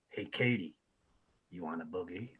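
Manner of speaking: low, hushed teasing voice, leaning in close; Bob teasing Katie. Retold or imitated. Bob leans over to tease little Katie, low and hushed. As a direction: murmur it low and soft to a little kid. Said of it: "he leans over to her and he goes".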